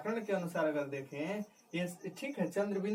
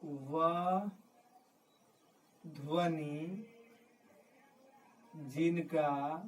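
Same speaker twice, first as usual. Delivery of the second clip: word by word, long gaps; slow dictation; much slower than usual. Real, not acted. Teacher dictating a definition to write down, slow dictation. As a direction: draw out each word for note-takers.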